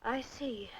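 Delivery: softly doubtful acknowledgement; soft, sceptical reply; unconvinced. Acted. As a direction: let it drop quietly, not believing a word. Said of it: "You sound unconvinced".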